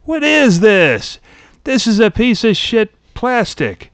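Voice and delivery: high, slow, incredulous complaint; mock outrage. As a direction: play an appalled customer, drawing out the words. Acts an outraged camera buyer.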